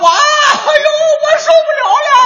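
high, fast hammed-up wail through laughter; mock scalded yell. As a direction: squeal like someone in boiling water, half laughing. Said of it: "煮的那个人那个嚷啊".

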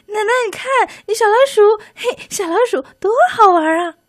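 put-on high, fast delighted child voice; excited little boy. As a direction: squeal with delight at the mouse. Child points out mouse delightedly.

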